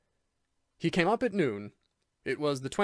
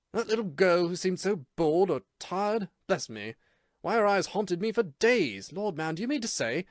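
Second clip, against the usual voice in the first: surprised exclamation; 6 semitones higher than usual. A narrator's reading. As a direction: gasp it out with wonder.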